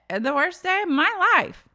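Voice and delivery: high, loud dramatic wail; imitating a tipsy castmate's wail; mock Brandy. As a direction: whine it like a drunk diva. Voicing Brandy mouthing off.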